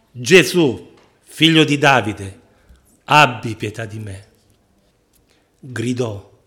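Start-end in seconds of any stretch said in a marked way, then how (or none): 0.1-4.3 grido di supplica. Acted. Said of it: "Allora egli gridò". (grido forte e supplichevole)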